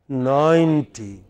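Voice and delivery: ninety with NINE drawn out long; long first syllable. Shown as a demonstration. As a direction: stretch NINE, then a short TY in ninety. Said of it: "It's a long".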